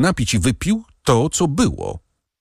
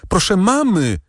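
even higher, more desperate repeat of mamy; more desperate call; 11 semitones higher than usual. Acted. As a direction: call again, panic rising. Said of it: "zawołała Valentin macochę". (jeszcze wyższe, rozpaczliwe wołanie)

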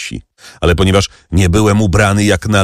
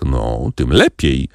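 greedy exclamation; 6 semitones lower, slower than usual. A narrator's reading. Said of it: "zawołał Kadrus".

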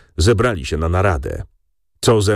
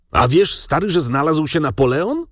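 higher-pitched, stirred-up announcement; agitated calling out; 8 semitones higher than usual. A narrator's reading. Pan Raczek rushes in to his dying friend with news, agitated calling out. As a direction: call out the astonishing news, voice raised in pitch. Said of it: "dziwnie wzburzony, i stojąc na środku izby zawołał".

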